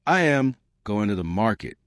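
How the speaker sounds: "I am", pause, "going to the market"; careful learner's textbook delivery; every word said in full; separated words. Shown as a demonstration. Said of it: "taught to speak like this".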